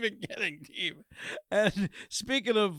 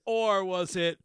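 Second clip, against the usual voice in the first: normal talking voice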